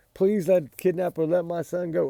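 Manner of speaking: high, loud pleading plea; mock pleading parent. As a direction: beg like a desperate parent. Parents voiced begging for child.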